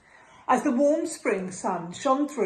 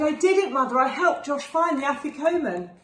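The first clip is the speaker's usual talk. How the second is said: proud excitement; 6 semitones higher, 4 dB louder than usual. Acted. Sammy proud of helping.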